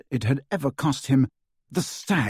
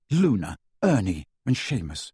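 plain reading voice

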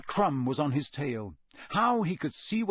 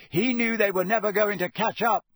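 raised voice over noise; louder, higher, explaining over a crowd; Harry shouting back; 6 semitones higher, 5 dB louder than usual. A narrator's reading. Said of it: "Harry shouted back over all the noise".

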